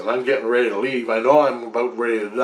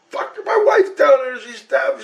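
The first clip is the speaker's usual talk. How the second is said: retold desperate yell; 12 semitones higher than usual. Dying man yells up to the family about his wife, urgent retold yelling. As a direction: yell up at them in protest. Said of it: "and I yell at 'em".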